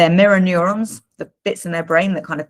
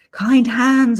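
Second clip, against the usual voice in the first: mock gentle-parenting voice; 7 semitones higher, 4 dB louder than usual. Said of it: "sort of say".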